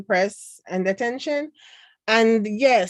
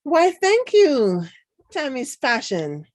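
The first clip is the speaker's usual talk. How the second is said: posh grateful voice; 6 semitones higher, 4 dB louder than usual. Pretend. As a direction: put on a fancy gracious voice. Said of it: "somebody likes my, my voices".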